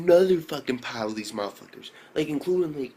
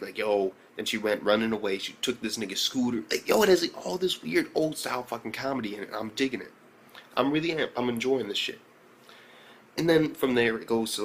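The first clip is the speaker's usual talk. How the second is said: ordinary talk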